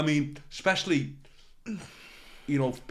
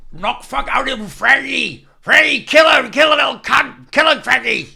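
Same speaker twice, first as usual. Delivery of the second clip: bellowed high Cornish heckle, much louder; Cornish heckler shouting; 11 semitones higher, 15 dB louder than usual. Retold or imitated. Drunk heckler urging Freddie on, loud Cornish bellow. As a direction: yell it like a pirate egging on a fight. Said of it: "he started shouting out".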